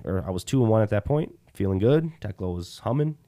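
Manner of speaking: ordinary talk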